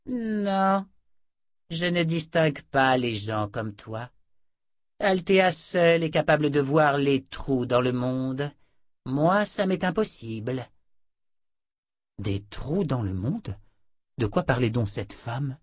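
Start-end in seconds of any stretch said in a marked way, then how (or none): 0.1-10.7 lightly voiced, firm sorceress; dismissive sorceress. A narrator's reading. Sorceress refuses to stand in for sister, dismissive. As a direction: refuse her with slight disdain.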